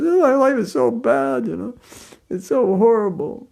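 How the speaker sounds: high, whiny self-pity; mock self-pity. Mocking a self-pitying person moaning about life, high whine.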